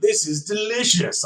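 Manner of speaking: high, hammed-up villain line; Bison voice. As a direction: lightly ham up the villain. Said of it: "He was like".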